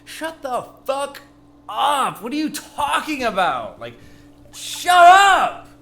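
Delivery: angry yell at an online post; retold outburst. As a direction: blow up at the screen. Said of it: "I was like".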